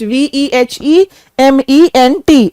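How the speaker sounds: V E H E M E N T spelled slowly letter by letter. Shown as a demonstration. Teacher to students, spelling V E H E M E N T. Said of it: "और फिर हमारा है वेहेमेंट".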